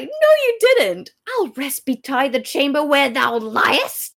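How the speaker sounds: high, loud theatrical disbelief; acted outrage. Lady Anne rebuffing Richard's bedchamber line, acted outrage.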